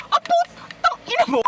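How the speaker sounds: high-pitched startled cursing; startled scream. Real, not acted. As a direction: scream a curse in sudden fright. Startled by scare in horror game. (gulat na tili na may mura)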